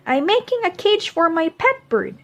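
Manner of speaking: put-on a boy proudly explaining his plan; eager child. Proud Mo answers his dad.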